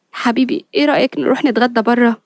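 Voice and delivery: usual speaking voice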